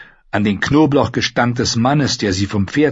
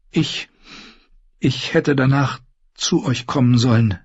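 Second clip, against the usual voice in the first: hesitant; 3 semitones higher than usual. Acted. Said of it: "sagte sie zögerlich".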